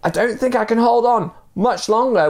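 high-pitched acted warning call; high, strained-sounding call for urgency; urgent warning. A narrator's reading. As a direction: warn loudly that your strength is going. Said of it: "warned the man on the bridge".

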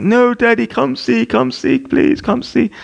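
high-pitched pleading little-kid imitation; frantic toddler. Two-year-old freaking out at Dad, frantic toddler imitation. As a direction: mimic a small child begging in panic, very high. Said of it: "he starts freaking out".

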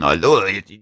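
French impression. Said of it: "that's my French impression".

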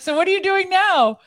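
high, loud, incredulous question; amused disbelief. Retold or imitated. Old friend asking what she does now, incredulous and high. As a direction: ask it like you can't believe the answer. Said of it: "She's like".

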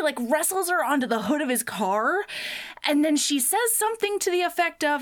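ordinary talk

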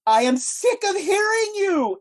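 high, loud, slow furious outburst; angry shout. Acted. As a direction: bellow it at them, fed up. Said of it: "she turns around and she says".

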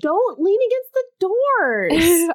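slow, high automated announcer voice; subway announcement. Retold or imitated. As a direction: drawl it like a train PA.

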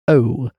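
a weighty, isolated "O"; emphatic O. Shown as a demonstration. Showing the co-host the overdone vowel, emphatic O. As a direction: hit "O" hard as a single sound. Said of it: "You put a lot of emphasis on".

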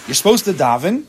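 normal talking voice

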